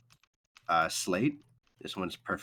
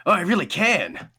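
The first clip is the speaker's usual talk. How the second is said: loud, high, amazed talking sword; excited sword; 7 semitones higher, 7 dB louder than usual. Acted. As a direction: gasp it out, thrilled to feel heat. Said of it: "he goes".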